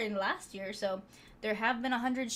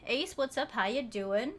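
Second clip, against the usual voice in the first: normal talking voice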